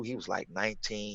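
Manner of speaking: usual speaking voice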